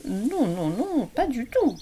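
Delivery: plus haut, lent, confus; ourson qui reconnaît. A narrator's reading. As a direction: ourson penaud. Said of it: "reconnut Petit ours mal peigné". (l'ourson reconnaît, penaud et aigu)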